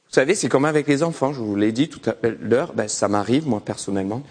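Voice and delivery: normal talking voice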